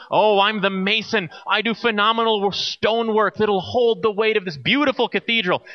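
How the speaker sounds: a craftsman showing off his stonework; proud mason. Retold or imitated. Proud mason explains his work.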